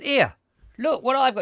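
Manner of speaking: loud, high hail to the postman; retold indignant shout; calling out.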